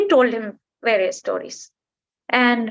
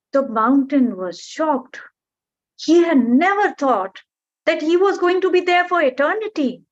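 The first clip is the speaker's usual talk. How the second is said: ordinary delivery, nothing marked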